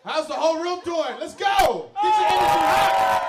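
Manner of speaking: high, loud rallying shout; hype shout. Real, not acted. Host rousing the whole room before the show, hyped shout.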